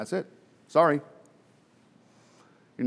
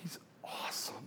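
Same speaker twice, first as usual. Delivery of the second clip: whispered, hushed in wonder; a reverent breath of praise; awed whisper; 21 dB quieter than usual. Real, not acted. Preacher marvelling at Jesus, awed whisper.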